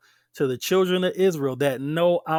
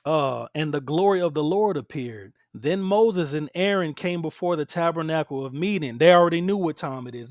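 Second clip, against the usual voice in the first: usual speaking voice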